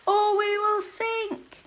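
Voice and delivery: high, loud, slow warning; shouted warning. A narrator's reading. Soggy warning Scruffy of sinking, high loud slow shout. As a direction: shout the danger slowly over the storm. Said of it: "shouted Soggy".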